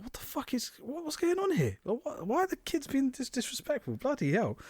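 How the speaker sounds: high-pitched disbelief, way up; high, stunned mock outrage; shocked outburst. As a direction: squeal it like you can't believe it. Shocked at kids spitting chips.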